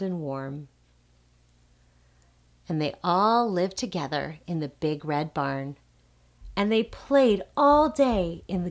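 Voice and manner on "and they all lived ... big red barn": usual speaking voice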